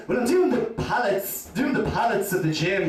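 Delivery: "pallets" said for Pilates, like the wooden pallets; lightly voiced date mispronouncing "pallets"; mispronounced pallets. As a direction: say "pallets" for Pilates, missing the word. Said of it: "She goes".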